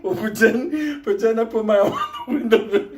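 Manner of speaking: high, laughing through the quote; can barely get the words out for laughing; squeaky giggling retelling of a friend's line; Benny's line through laughter. Retold or imitated. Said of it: "he's telling the teacher".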